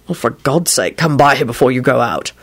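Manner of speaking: low, urgent man's voice; impatient boyfriend. A narrator's reading. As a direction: cut in low and pleading.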